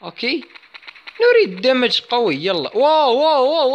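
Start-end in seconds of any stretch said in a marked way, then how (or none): 2.8-3.7 excited. Real, not acted. Impressive hit in game.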